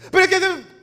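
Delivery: high, loud protesting whine; whining congregant. Pretend. Congregant protests to the pastor in a high whine. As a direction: cut in with a protest.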